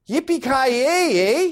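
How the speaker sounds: high, long drawn-out movie catchphrase; triumphant catchphrase. Acted. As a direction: stretch it out high like a victory cry. Quoting Die Hard's famous ending line.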